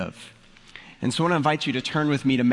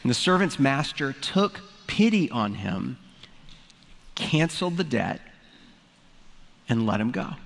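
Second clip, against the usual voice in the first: a pastor reading the parable aloud to the congregation; scripture reading; 3 semitones higher than usual. Acted. Pastor reading Matthew to his church, in a scripture-reading voice. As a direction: read it like the Gospel text, measured and clear.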